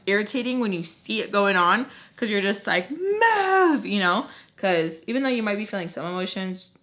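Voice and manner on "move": high, drawn-out mock shout; frustrated shout. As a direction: urge them on with one long, high cry. Imagined urging someone who froze.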